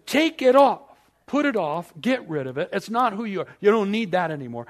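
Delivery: loud, high declaration; forceful command. Acted. Jesus ordering believers to shed the old life, loudly. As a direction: proclaim it like a command.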